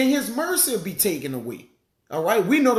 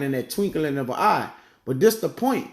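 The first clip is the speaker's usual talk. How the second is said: ordinary talk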